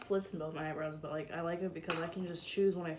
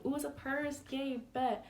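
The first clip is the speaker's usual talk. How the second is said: high-pitched mock delight; playful, higher-pitched excited thought; retold excitement; 6 semitones higher than usual. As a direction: act out a thrilled gift reaction, pitched up. Retelling delight at grandfather's gift.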